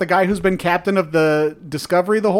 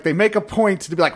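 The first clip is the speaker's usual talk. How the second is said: ordinary talk, nothing marked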